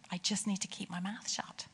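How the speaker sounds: a private resolution, barely voiced; murmured inner decision; whispered thought. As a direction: whisper it to yourself. Private resolve after hearing God.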